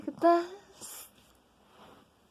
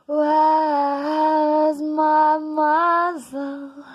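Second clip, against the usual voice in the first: louder and slowed, each word pressed out; loud slow demand; 7 dB louder, slower than usual. Acted. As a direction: bark the question slowly to the crowd. Poem outburst after whispers.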